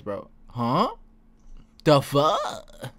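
ordinary talk

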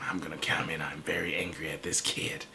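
soft, breathy sneering whisper; mock whisper. An adult hater plotting a comment, in a mocking whisper.

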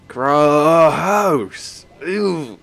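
milk the disgust on gross; hammed-up yuck; exaggerated disgust.

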